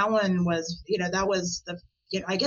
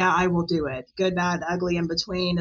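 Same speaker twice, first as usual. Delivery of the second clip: ordinary delivery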